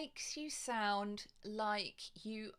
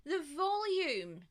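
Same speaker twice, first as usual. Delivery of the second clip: louder; 7 semitones higher, 7 dB louder than usual. Shown as a demonstration. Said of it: "varying the volume".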